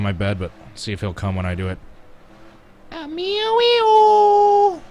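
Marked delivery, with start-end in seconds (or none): 2.9-4.8 very high, loud cat-calling squeal; high-pitched over-the-top voice. Shown as a demonstration. Owner calling his cat to see if he comes, over-the-top squeal. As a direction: stretch the pet's name into a meowing falsetto. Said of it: "really high-pitched, over-the-top voice".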